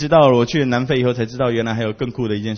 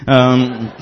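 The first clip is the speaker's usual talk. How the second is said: normal talking voice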